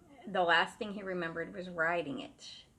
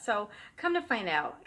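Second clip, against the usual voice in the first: ordinary talk, nothing marked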